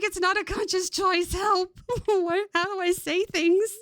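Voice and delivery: high, hammed-up cry for help; mock panicked plea. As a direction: fake alarm at her own voice. Jokes she can't stop the accent.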